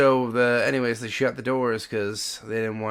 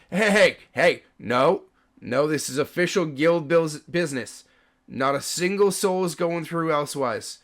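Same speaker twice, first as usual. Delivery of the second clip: raised, firm official voice; warning call; 5 semitones higher, 4 dB louder than usual. Acted. Guard turns back the restless crowd, loud warning.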